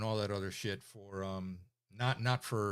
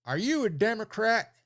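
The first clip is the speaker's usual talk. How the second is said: high, loud mimicry of a suspicious official; exaggerated, pitched-up interrogation; interrogating governor; 11 semitones higher, 8 dB louder than usual. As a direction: imitate an old governor grilling an applicant. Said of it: "he gets really, really close".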